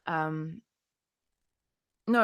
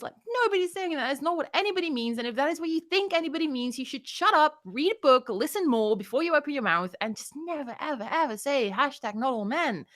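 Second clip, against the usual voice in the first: exasperated; 7 semitones higher than usual. Real, not acted. Heated rant at critics.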